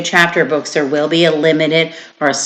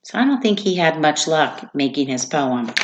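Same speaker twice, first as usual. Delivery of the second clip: ordinary talk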